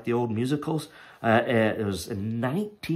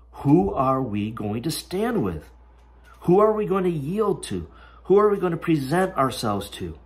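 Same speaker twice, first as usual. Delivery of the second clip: climbing higher with each question; rising preacher questions; 6 semitones higher, 3 dB louder than usual. Real, not acted. Preacher challenging the congregation, rising higher. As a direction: press the congregation to choose.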